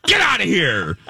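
flick the angel off your shoulder; mock shout get out; shooing away. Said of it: "I took my fingers and went".

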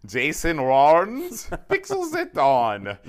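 very slow, hammed-up accent drifting into cartoon Russian; bad foreign accent. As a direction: stretch each name in a muddled foreign accent. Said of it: "from Australian English to Boris Badenov".

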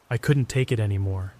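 plain reading voice

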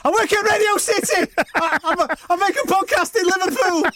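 squeaky-high put-on Scouse, laughing; panicked Scouse accent. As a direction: plead for your life in a hammed-up Scouse accent. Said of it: "put your best Scouse accent on".

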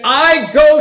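"I go" pitched high and loud; self-elevating stress on "I"; emphatic I. Acted. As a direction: punch the "I" in "I go", self-important. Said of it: "is emphatic in the sentence".

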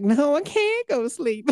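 put-on high, slow, drawn-out kid voice; mock little-kid protest, stretched out; whiny kid imitation. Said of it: "And he's like".

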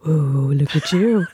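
slow cooing pet voice; baby talk to dog. Acted. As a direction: coo at the puppy.

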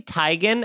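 Teigen said as TIE-gin; corrected pronunciation. Retold or imitated. Celebrity correcting everyone, "Teigen" as TIE-gin. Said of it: "saying my name wrong the whole time".

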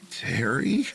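high, rising, uncertain call; uneasy questioning call with pitch lifting; nervous call. A narrator's reading. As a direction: call out tentatively for a missing friend. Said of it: "he called out nervously".

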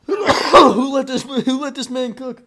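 high laughing exclamation; cough-laugh it out; laughing in disbelief. Real, not acted.

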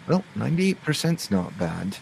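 in-character banter with a faint assumed voice; lightly voiced character. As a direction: shrug it off with mild approval.

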